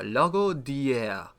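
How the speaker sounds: slow, drawn-out mock French accent. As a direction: savour the name in a put-on French accent. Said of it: "That's an excellent French accent".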